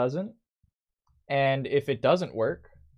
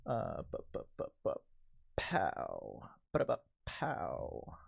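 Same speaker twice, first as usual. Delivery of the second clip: beatbox; 5 semitones lower, 11 dB quieter than usual. Retold or imitated. Mouthing a drum hit sound.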